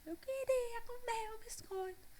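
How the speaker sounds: high, slow whispered little voice; small child whisper. Retold or imitated. Mother voicing her daughter's whispered wish for the biscuit. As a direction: whisper like a sulky toddler. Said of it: "me fale aí".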